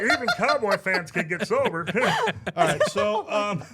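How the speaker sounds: loud, high laughing words. Real, not acted. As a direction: laugh your way through the line. Laughing at own Cowboys joke.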